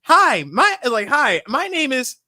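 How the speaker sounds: high, chirpy toy doll voice; sweet doll greeting, sing it high; good guy doll voice. Retold or imitated. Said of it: "The most Chucky shit ever".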